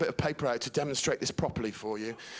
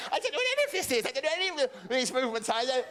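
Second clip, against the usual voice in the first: much higher, fast protesting mother; squeaky panicked mum backpedalling; flustered mum; 9 semitones higher, much faster than usual. Retold or imitated. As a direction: gabble out the denial. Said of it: "She went".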